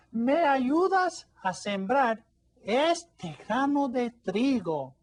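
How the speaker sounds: storybook hen asking the dog; little hen pleading. Acted. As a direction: draw out the request like a coaxing hen.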